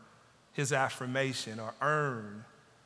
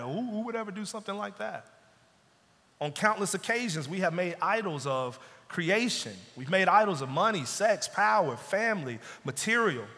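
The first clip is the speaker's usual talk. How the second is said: ordinary delivery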